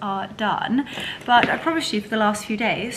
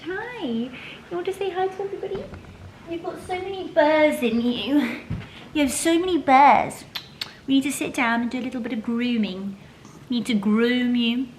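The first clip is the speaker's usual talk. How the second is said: a light coddling pet-talk voice. Acted. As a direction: owner cooing to the dog about grooming, in gentle pet talk. Talking to her pet.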